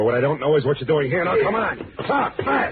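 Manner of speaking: building to a very high, strained yell; forceful demand. Acted. Detective roughing up intruder for answers, heated demand. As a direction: press him harder with each phrase until yelling.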